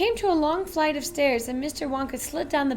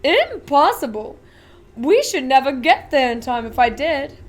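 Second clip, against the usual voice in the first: louder, showman factory owner voice; a giddy chocolatier showing off; Willy Wonka, grand and eager; eccentric Wonka; 8 dB louder than usual. Acted.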